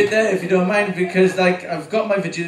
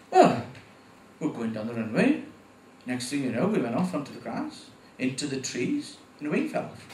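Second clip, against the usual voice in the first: laid-back Welshman; 5 semitones lower, 5 dB quieter than usual. Retold or imitated. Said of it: "laid back Welsh people".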